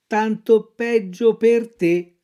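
lightly voiced cat, slow echo; a hint of a dim cat repeating. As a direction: parrot the fox lazily. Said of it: "ripeté il gatto".

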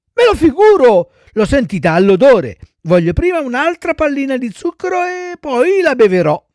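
put-on high, loud little-boy refusal; whining child puppet. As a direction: protest like a fussy child. Voices Pinocchio stalling. (burattino lamentoso, voce acuta)

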